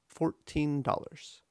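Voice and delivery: put-on "Fourteen" in two even beats, FOUR-TEEN, then "dollars"; march through "Fourteen dollars" with a full, long "teen"; "Fourteen" split evenly before "dollars"; equal stress. Shown as a demonstration. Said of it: "equal stress".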